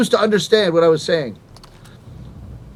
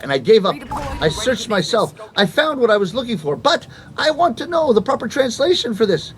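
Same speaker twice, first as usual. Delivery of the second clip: normal talking voice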